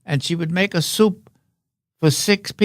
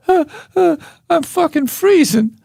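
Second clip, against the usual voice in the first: shiver it out like a tiny freezing cub; high trembling punchline voice; squeaky baby bear; 12 semitones higher than usual. Acted.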